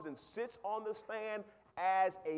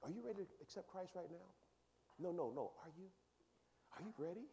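softer, fast and pressing questions; mock pushy evangelist; 3 semitones higher, 10 dB quieter, faster than usual. Pushy believer pressing a stranger to accept Christ, mock soft pleading. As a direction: lightly imitate someone pushing for a conversion. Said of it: "You know, you, you".

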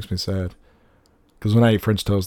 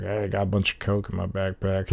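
soft mock confession; prank kid; 8 dB quieter than usual. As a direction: confess it to the cop softly. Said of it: "He's like".

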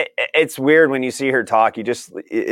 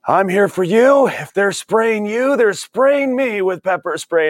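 mocking the mayor; 9 semitones higher, slower than usual. Mocking Portland's mayor to his co-host, high and slow, sanctimonious. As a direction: ham up a preachy mayor playing the victim. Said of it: "all he does all day is".

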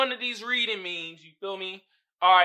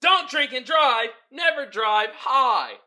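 loud, higher, drawn-out mock shout; yelling woman's reading; 6 semitones higher, 9 dB louder, slower than usual. Host reads the sign as the yelling woman, loud mock shout. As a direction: shout the warning like an outraged housewife. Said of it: "I read at the bottom with the woman".

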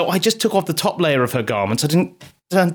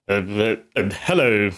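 hesitant, hushed and lower, very proper; posh male voice; 7 semitones lower than usual. Acted. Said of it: "really quite posh male voice there".

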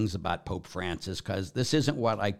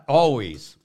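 usual speaking voice

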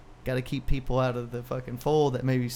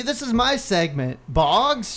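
indignant; 7 semitones higher, 4 dB louder, slower than usual. Real, not acted. Scolding a co-host.

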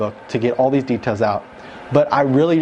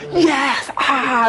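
much higher joyful yell; retold angel yelling; 10 semitones higher than usual. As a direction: whoop it like a party crowd. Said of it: "they were, like, yelling".

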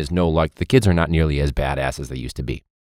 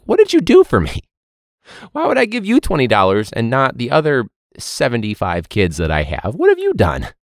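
much higher, louder exasperated comeback; incredulous retort; 6 semitones higher, 4 dB louder than usual. Retold or imitated. Teacher snapping back at a begging student, exasperated and high. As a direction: throw the question back in disbelief.

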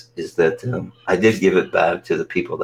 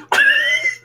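high laugh; 3 dB louder than usual. Real, not acted. Laughs after puppet voice.

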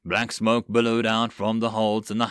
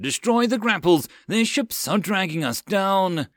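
much higher, raised battle command; shouted order; 8 semitones higher than usual. Acted. John commanding the crew to destroy grapples, shouting. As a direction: drive the crew to cut loose.

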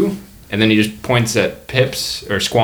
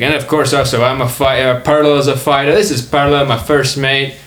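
put-on higher muddled Australian-Scottish accent; raised-pitch jumbled Australian-Scottish host; mixed-up comic accent; 4 semitones higher, 4 dB louder than usual. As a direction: introduce the crew in a wandering Australian-ish brogue. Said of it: "He's Australian and Scottish".

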